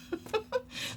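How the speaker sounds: a short, high, airy laugh; breathy laugh. Real, not acted. Laughing at herself while telling viewers she is angry. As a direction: laugh quietly through the breath. Laughing at her own anger.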